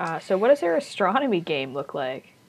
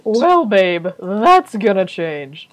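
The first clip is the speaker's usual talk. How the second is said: smug; 8 dB louder, much slower than usual. Real, not acted. Teasing boast to GM.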